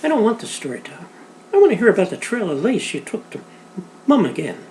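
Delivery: put-on high, pushy little girl's demand; girl begging her father for a different story, high and insistent; insistent child. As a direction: wheedle it out of him. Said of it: "Alice insisted".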